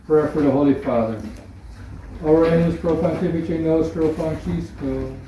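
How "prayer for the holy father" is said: usual speaking voice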